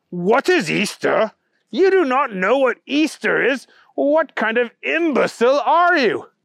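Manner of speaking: high, incredulous scolding; appalled outrage. A narrator's reading. Bunny appalled that Booger doesn't know Easter, outraged. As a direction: build from disbelief into indignation.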